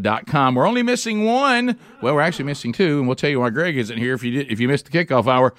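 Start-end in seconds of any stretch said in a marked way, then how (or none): none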